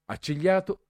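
plain reading voice

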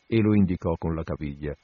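usual speaking voice

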